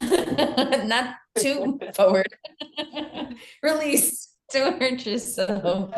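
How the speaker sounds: laughing through a warning. Real, not acted. Friend just tumbled over.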